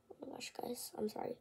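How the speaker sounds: whispered apology to viewers; breath-only aside. Real, not acted. Breaking off the story to apologise, whispered.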